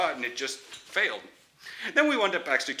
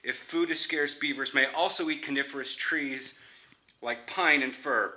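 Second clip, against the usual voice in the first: ordinary talk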